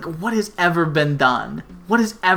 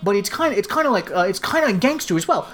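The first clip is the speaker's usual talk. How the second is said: fast, higher mock New York gangster accent; terrible American accent; 4 semitones higher, faster than usual. Imitating the reporter kid's bad American accent, fast and high. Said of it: "terrible American accent".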